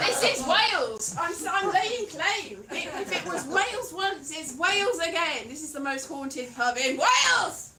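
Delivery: loud, emphatic claim-staking; hammed-up territorial shout; mock proclamation. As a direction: declare it to the whole room. Mock claim of pub for Wales.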